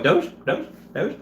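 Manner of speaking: high, British-tinged seagull squawk; accidental British accent. Retold or imitated. Streamer mimicking the mob as gulls, accidental British squawk. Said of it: "I don't know why I became British".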